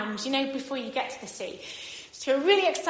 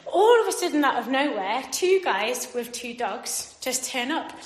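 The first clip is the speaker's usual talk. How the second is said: normal talking voice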